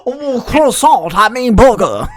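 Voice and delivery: loud, high, hammed-up Davy Jones accent. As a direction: belt it out as a mock Davy Jones. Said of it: "Davy Jones' accent from Pirates".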